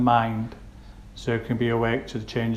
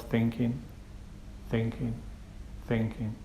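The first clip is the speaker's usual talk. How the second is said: hushed, gently repeated noting; note it softly, leaving a pause after each; soft, kind mental note; 9 dB quieter than usual. Shown as a demonstration. Meditation teacher showing how to note a thought, soft and kind. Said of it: "a soft and kind mental note".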